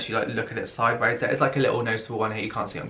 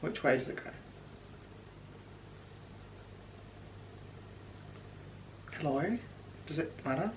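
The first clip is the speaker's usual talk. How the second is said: nervous whisper; 3 semitones higher, 19 dB quieter, faster than usual. Real, not acted. Applying screen protector.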